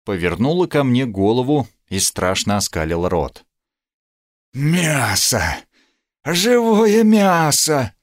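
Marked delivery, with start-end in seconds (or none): 4.5-7.9 high, drawn-out cry of a ravenous creature; frightful howl. A narrator's reading. Chained vampire woman sees the knight, frightful high howl. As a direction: wail it hungrily, pitched far up. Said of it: "издала ещё пару страшных воплей".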